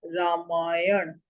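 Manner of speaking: Ramayan said very slowly, ending on a clear ण; slow correct reading. Shown as a demonstration. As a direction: stretch out Ramayan and land on ण. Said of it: "शुद्ध शब्द क्या है?". (धीमा, साफ़ उच्चारण रामायण)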